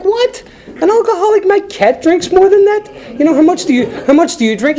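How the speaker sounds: shocked, indignant protest to the priest; incredulous outburst. Retold or imitated. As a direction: squeal it as if the idea were absurd. Outraged retort to the priest.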